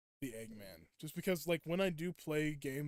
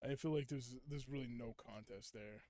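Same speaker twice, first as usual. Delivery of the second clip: ordinary talk, nothing marked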